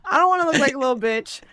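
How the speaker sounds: fast, loud, laughing retelling. Real, not acted. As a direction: push it out through laughter.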